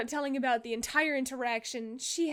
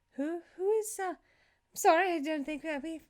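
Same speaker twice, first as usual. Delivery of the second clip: hesitant, higher-pitched worried politeness; acted mild panic under polite words; halting and a touch anxious; slightly worried; 5 semitones higher than usual. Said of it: "slightly worried, slightly panicked".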